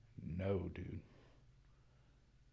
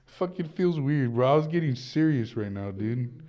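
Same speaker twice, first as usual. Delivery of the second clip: laughing, louder and higher, can't stay serious; laughing protest; 7 semitones higher, 9 dB louder than usual. Real, not acted. A guy laughing as he tells his friend he was being serious. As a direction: giggle through the protest. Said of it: "Why are you looking right at me".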